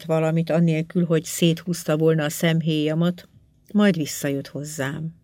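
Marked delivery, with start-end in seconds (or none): none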